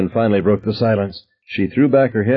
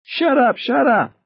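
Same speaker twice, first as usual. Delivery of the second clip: shouting; slower than usual. A narrator's reading. Ted shouting back.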